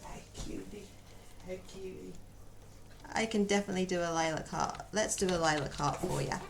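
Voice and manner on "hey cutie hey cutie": hushed, breathy coo to a pet; cooing softly. Real, not acted. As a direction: whisper sweetly to a cute animal.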